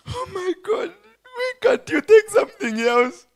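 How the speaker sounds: sobbing, pleading lament; anguished crying. Acted. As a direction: cry out to God in despair. Abraham pleading with God in anguish.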